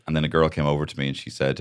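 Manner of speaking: ordinary delivery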